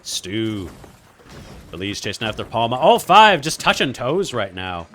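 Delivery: excited mock British play-by-play, swooping at big hits; British accent. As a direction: cast the match like a put-on British commentator. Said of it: "cast in British accent".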